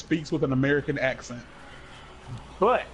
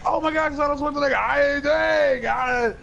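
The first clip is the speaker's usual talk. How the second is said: high, loud, gushing fan outburst; hammed-up excited shrieking, rushing at first; starstruck fan; 8 semitones higher, 3 dB louder than usual. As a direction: lose it over meeting your idol. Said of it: "you wanna go like".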